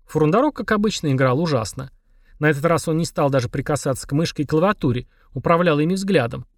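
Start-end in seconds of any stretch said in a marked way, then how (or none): none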